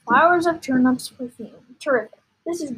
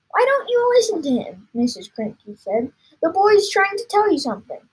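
scolding mother; 7 semitones higher, 4 dB louder than usual. Acted. Mother scolding father in story.

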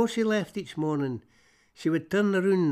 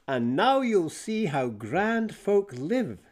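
slow, higher, lofty put-on city mouse voice; snooty city mouse; 6 semitones higher, slower than usual. City mouse showing off to her country cousin, slow snooty voice. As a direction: draw it out like a show-off from the big city. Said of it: "said the snooty city moose".

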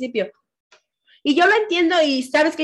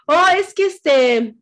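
higher, louder hesitant excuse; mock excuse; 4 semitones higher, 4 dB louder, slower than usual. Podcaster imitating a late reply to a friend, mock excuse. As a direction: stall with a put-on, high-pitched excuse.

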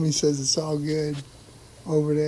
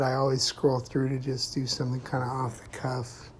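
ordinary talk

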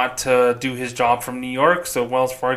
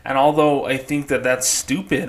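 usual speaking voice

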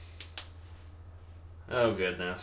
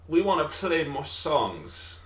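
mock British accent, drawn out; hammed-up slow British voice; 5 semitones higher, slower than usual. Band members demanding of their singer, mock British accent. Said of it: "I don't know why they're British".

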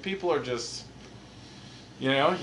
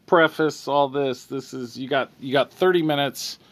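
officious cop reading the interview details into the recording, louder; police officer; 4 dB louder than usual. Acted. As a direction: play the cop stating it all for the record. Officer continues.

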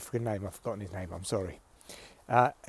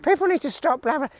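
mock activist whine; 10 semitones higher than usual. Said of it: "And they're like".

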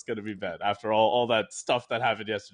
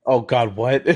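ordinary talk, nothing marked

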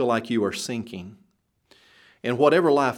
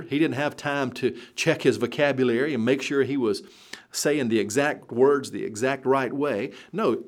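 ordinary delivery

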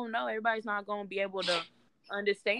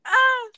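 overwhelmed cry; 14 semitones higher, 10 dB louder than usual. Real, not acted. Overwhelmed at the idea.

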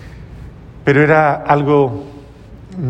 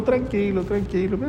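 high, much softer calming tranquilo; whispered-soft reassurance, pitched up; soothing hush; 6 semitones higher, 8 dB quieter than usual. Retold or imitated. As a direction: gently hush a man in pain. Saint calms the angry patient.

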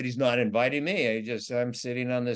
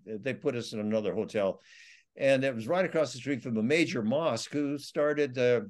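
usual speaking voice

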